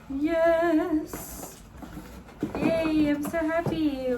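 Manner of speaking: high, squealing burst of joy; delighted squeal. Real, not acted. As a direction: squeal with real delight at the gift. Unboxing the new camera, delighted.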